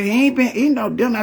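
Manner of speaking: excited telling. Retold or imitated. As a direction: rush it out, tattling. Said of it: "I said".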